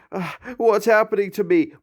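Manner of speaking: high frightened question; panicked. A narrator's reading. Blue in pain among the macaws, panicked. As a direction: panic about his body. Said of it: "Blue panicked".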